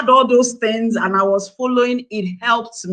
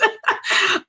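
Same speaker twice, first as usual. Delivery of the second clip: short laugh; 12 semitones higher than usual. Real, not acted. Laughs at morning school battles.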